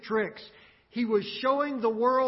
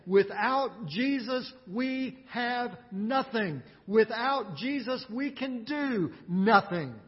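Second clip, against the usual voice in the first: slow emphatic; slower than usual. Real, not acted. Preacher stresses without Jesus.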